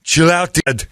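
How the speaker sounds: put-on Arnold Austrian accent. Customer brushing off the store clerk in an Austrian accent. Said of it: "in that accent".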